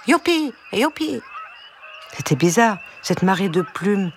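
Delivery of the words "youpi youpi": very high gleeful whoop; crying yippee. A narrator's reading. Brown hen practising walking backwards, high-pitched cry. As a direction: squeal it high without much volume. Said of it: "en criant".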